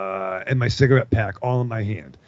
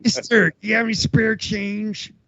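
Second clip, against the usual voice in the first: imitating a panhandler; 9 semitones higher, slower than usual. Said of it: "looks like, um, the cat from, um, U H F".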